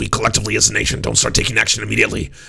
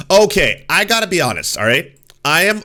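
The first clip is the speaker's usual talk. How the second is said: fired up; 7 semitones higher, 3 dB louder than usual. Real, not acted. Bursting into a rant.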